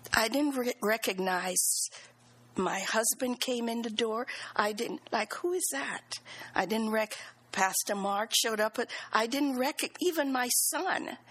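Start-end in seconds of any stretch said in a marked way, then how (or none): 5.3-6.2 softer-edged, higher puzzled question; retold bewilderment. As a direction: ask it as if not recognizing a visitor. Retold confusion on waking in ICU.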